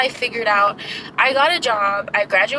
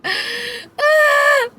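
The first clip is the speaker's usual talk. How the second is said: a short high yelp of frustration; high, louder exasperated shout; sharp cry; 12 semitones higher, 4 dB louder than usual. Real, not acted. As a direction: let out a sudden pained cry. Overwhelmed outburst mid-rant.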